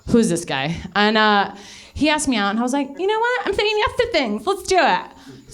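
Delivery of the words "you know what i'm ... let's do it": high-pitched self-parody; imitating a gullible girl agreeing; mock naive resolve. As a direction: chirp it high, mocking your own eagerness. Replays her naive yes-to-things resolve.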